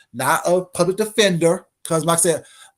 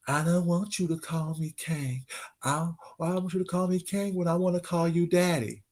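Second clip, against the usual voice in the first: mocking quote; 9 dB quieter than usual. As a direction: mimic him sweetly, taunting. Mocking the rival's quoted denial.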